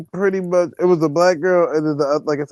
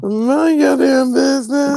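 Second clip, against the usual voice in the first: high, slow mock comeback, louder; a hammed-up telling-off of a nosy stranger; mock snap; 10 semitones higher, 3 dB louder, slower than usual. To the nosy lady, a high slow mock telling-off.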